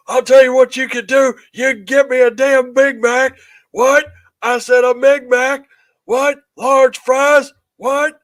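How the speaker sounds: high, loud wrestler bark; Stone Cold impression. Stone Cold E T shouting his order at the drive-through. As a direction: yell the order like a pro wrestler.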